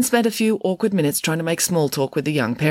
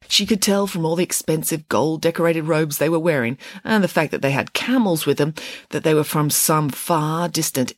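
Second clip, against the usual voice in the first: plain reading voice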